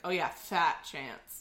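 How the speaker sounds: low, drawn-out sarcasm; sarcastic. Shown as a demonstration. Host demonstrates the sarcastic meaning of a phrase, sarcastic. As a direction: say it slowly, dripping with sarcasm. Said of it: "just a sarcastic version of that".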